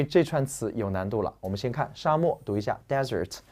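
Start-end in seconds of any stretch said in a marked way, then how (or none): 2.9-3.4 DESERT as DEZ-ert, front stress; first syllable of "desert" strong, second weak. Shown as a demonstration. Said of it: "重音在前面".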